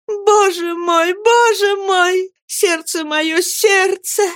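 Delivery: high, slow anguished cry; a young girl's high, slow, anguished outcry. A narrator's reading. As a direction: wail it out in despair. Girl crying out in despair.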